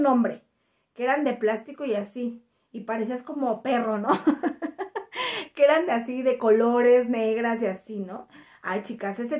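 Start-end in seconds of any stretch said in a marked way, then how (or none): 4.2-5.5 light laugh. Real, not acted. Laughs at own joke.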